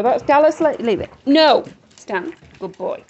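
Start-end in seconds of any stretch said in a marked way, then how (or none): 1.3-1.6 loud firm scolding no; sharp no. Real, not acted. Owner scolding a dog grabbing treats, sharp loud no. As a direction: stop the dog in its tracks.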